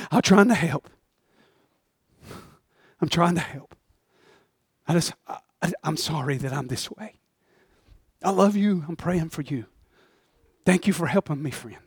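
soft, fading apology; humble thanks. Acted. Lazarus thanking the friend who carries him, soft and fading. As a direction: quietly say sorry and thank him.

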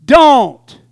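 loud, emphatic one-word warning; forceful command. Acted. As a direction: punch it out loud and high. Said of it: "the Holy Ghost, who says".

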